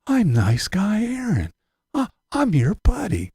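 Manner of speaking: high, slow fake nice-guy voice; mock nice guy Aaron. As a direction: act sweet and harmless, slow and high. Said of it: "under the guise of the".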